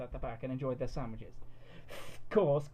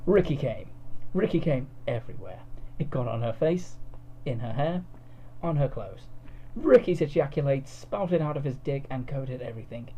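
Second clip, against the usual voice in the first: ordinary delivery, nothing marked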